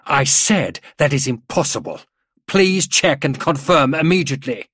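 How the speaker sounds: loud, high, curt command. A narrator's reading. General ordering the major to recheck, curt. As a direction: insist curtly, as a superior officer. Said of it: "Gruber's voice was curt".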